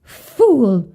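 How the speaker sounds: a sharp, loud scornful snap. A narrator's reading. Stepmother scolding Hansel, sharp and loud. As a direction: spit the word out at the boy. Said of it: "said the wife".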